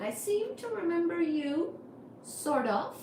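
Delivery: ordinary talk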